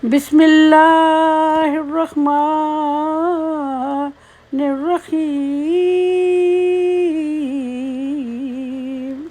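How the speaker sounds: slow, high, melodic chant; Quranic recitation. Acted. Teacher opening the lesson, slow melodic recitation. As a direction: recite it slowly like tilawat.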